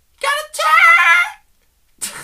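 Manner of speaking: piercing high parody shriek; mock screaming. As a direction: squeal it out like a contestant. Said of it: "That's what it sounds like".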